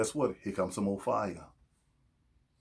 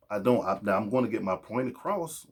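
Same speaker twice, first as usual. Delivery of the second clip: normal talking voice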